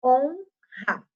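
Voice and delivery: "Honra" broken as "Hon", pause, "ra"; split into syllables. Shown as a demonstration. Teacher showing where N and R separate, "Honra" in two syllables. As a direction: pause after the N in "Honra", then a strong R. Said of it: "o R tá em outra sílaba".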